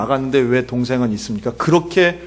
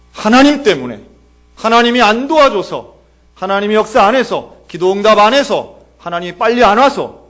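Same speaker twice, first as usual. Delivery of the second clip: loud, high, punched accusations; resentful blaming; 6 semitones higher, 7 dB louder than usual. Acted. Grieving believer blames God for not helping, loud resentment. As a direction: heap up blame phrase by phrase. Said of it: "원망을 덮어요".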